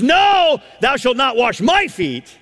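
high, loud NO, then insistent; Peter's high-pitched protest; emphatic refusal. Retold or imitated. Acts Peter refusing Jesus.

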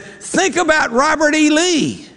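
very high-pitched, hammed-up plea; really desperate, acted. Counselor's last appeal to jumper, hammed-up desperation. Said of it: "he's really desperate what to think about".

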